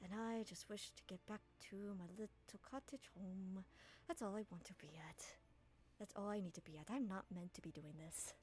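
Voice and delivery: soft half-hidden whisper; very softly. Acted. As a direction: murmur it under your breath. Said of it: "very softly".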